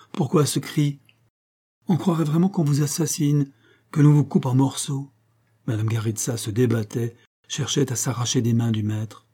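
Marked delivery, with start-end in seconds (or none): 0.1-5.1 higher, teasing tone with a smile; smiling. A narrator's reading. Master teases the woman about her cry, smiling. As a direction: tease the victim's overreaction, pitched up. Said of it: "fit-il en souriant".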